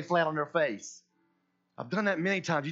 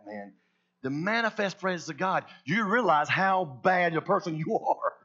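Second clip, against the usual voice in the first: usual speaking voice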